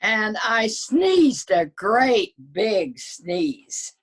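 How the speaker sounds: slow, high and loud, drawn out; dramatic buildup. Acted. Wolf dramatizing his sneeze to readers, slow and loud. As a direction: stretch the sneeze line big.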